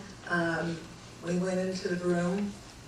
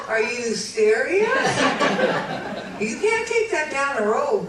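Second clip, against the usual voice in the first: incredulous farmer; 6 semitones higher, 6 dB louder than usual. Retold or imitated. Farmer disbelieving.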